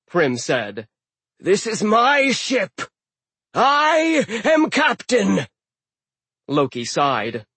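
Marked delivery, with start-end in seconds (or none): 1.4-5.5 slow booming frost giant; giant captain voice. Acted. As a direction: speak like an old ice giant. Hrym voiced.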